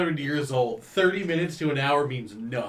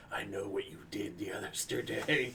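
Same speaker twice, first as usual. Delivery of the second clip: creepy note voice; 6 semitones lower, 10 dB quieter than usual. Retold or imitated. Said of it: "this really bad hand".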